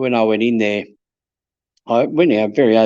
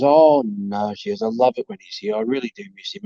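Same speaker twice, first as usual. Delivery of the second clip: lightly voiced old lady, higher and softening; elderly widow voice; 10 semitones higher than usual. Elderly widow quoted about husband.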